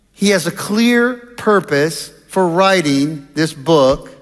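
normal talking voice

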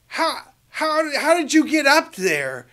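high, loud cry of disbelief; amazed call. Retold or imitated. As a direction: shout up in astonishment. Man calls up in disbelief.

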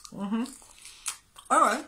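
chewing while talking; talking through a crunchy mouthful; crunching. Real, not acted. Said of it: "Sorry for the crunching".

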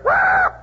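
sudden high wail as the whistle blows; a startled soldier's yelp in the dark, high and loud; alarm cry. Retold or imitated. Waking soldier jolted by the whistle, a high yelp. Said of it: "and you hear".

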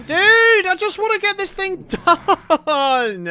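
high, drawn-out plaintive moan; exasperated whining. Real, not acted. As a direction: plead with the game like a thwarted player. Pleading after dying in game.

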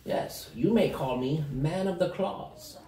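slow, ceremonial gnome proclamation; grandiose gnome. Acted. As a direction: announce yourself with mock pomp. Gnome announces himself.

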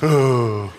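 low drawn-out ooh; same sheepish face-saving ooh. Retold or imitated. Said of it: "universal kind of".

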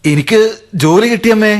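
high, bursting with joy; excited news. Acted. Son telling his aunt he got the job, excited. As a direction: blurt out the good news.

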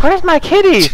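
put-on very high-pitched child voice; little kid. Little kid waking up to a missing cat, high childlike plea. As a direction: imitate a small child's plaintive question. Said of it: "some little kid who's gonna wake up".

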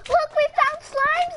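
high, loud excited call. Real, not acted. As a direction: call out the find with joy. Kid excitedly shows slimes.

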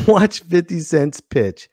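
ordinary delivery

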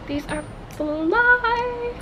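high, slow, drawn-out delight; excited. Real, not acted. Unboxing sunglasses for viewers, slow high delight. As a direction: savor each word, thrilled.

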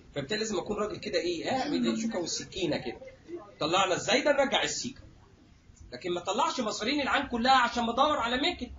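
ordinary talk, nothing marked

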